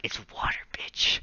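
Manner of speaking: hushed, cruel whisper; taunting little brother after the prank. Retold or imitated. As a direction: whisper it like a villain. Twisting the knife on brother.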